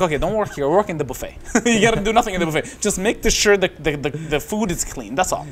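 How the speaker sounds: mock-cheerful pitch to him, words tumbling out fast with a laugh; imitating his friend. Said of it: "So he's like".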